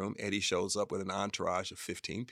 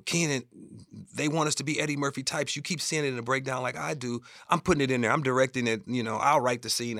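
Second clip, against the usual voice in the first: higher, louder retold insistence; insisting; 5 semitones higher, 4 dB louder, faster than usual. Director telling Keenen he's putting it in, insistent. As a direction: press the case, acting out the argument.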